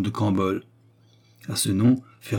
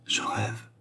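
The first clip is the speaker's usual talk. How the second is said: murmur; 8 dB quieter than usual. A narrator's reading. Stunned Fernand doubting his senses, murmuring. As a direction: murmur it as if to oneself. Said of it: "murmura Fernand".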